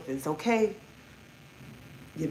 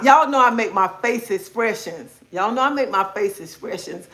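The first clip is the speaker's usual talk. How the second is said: ordinary talk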